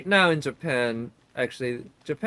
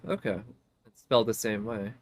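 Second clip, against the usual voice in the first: ordinary talk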